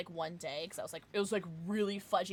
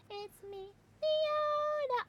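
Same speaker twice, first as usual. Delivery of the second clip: high, sing-song "it's me" voice; a playful greeting in a put-on high voice; character greeting; much slower than usual. Voicing a character again.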